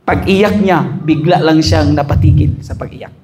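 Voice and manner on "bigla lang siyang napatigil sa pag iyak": ordinary talk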